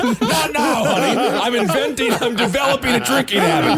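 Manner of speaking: high-pitched mock scientist, half laughing; mock busy inventor. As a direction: fend off an interruption in a high comic voice. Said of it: "like you're the scientist inventing flubber in the basement".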